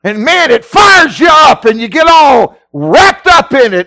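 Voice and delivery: hammed-up revival excitement; fired up. Acting out being fired up.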